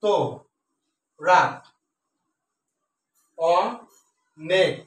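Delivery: each syllable spoken alone with pauses between. Shown as a demonstration. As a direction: chop the verse into single syllables, slowly. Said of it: "বনটা একসাথে উচ্চারণ করে".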